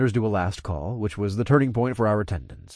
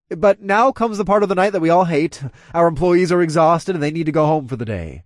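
loud, higher-pitched address to a room; announcing; 5 semitones higher, 6 dB louder than usual. Acted. Club owner telling guests it's closing, announcing loudly. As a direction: project the bad news to the crowd.

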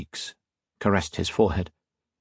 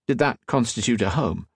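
usual speaking voice

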